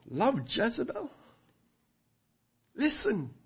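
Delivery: lightly voiced scornful king; voicing Ahab. Preacher voices Ahab denying love for Jezebel, offhand. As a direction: shrug it off like a self-serving king. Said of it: "Ahab would have gone".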